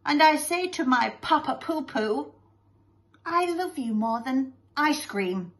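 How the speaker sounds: put-on high, sweet little-boy voice; Master Lovey-Dovey. Son declares love to his father, gushing. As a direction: declare it adoringly to papa.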